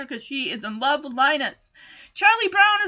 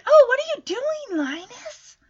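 high, sing-song lovestruck question; smitten little girl; 9 semitones higher than usual. Acted. Voicing Sally, smitten with Linus.

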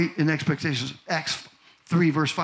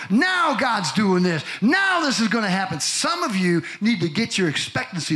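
higher-pitched, emphatic declarations; fired-up preaching; 5 semitones higher than usual. Real, not acted. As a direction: preach it, hitting each NOW. Preaches at full force.

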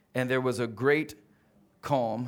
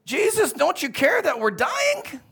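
much higher, louder desperate cry; panicked plea; 13 semitones higher, 6 dB louder than usual. Acted. Disciples shouting to Jesus over the storm, panicked. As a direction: wail it like they're sinking. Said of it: "They didn't talk like that".

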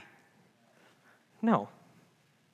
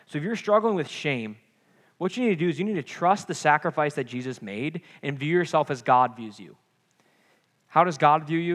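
usual speaking voice

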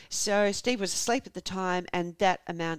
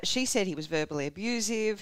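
ordinary talk, nothing marked

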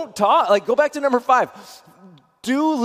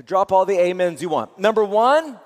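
usual speaking voice

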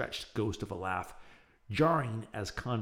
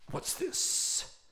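unvoiced, higher, venomous hiss. Evil Professor Waverly hissing at the intruding boys. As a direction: spit the question like poison. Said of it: "Waverly hissed venomously".

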